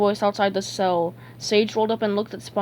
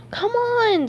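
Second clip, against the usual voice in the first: high, pleading push; insistent, pitched-up prodding; urging; 13 semitones higher than usual. A narrator's reading. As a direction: urge it in a high cartoon voice. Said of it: "SpongeBob urged".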